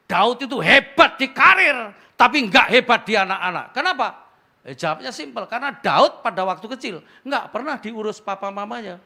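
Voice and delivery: loud, high preaching voice; preaching at full force. Acted. Pastor drives the point home to the congregation, loud and high.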